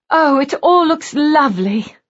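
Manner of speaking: put-on a young bride gushing over a gloomy mansion; breathless delight. A narrator's reading. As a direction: gush it out, all bright delight. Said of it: "in breathless accents".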